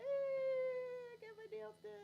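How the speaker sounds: high, soft, drawn-out squeal; showing off. Real, not acted. Showing viewers her new nails, high soft showing off. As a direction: coo it high, slow and quietly.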